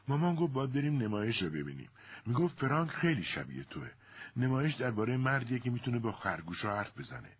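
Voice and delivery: put-on high-pitched child-like chatter; nonstop chatterbox. A narrator's reading.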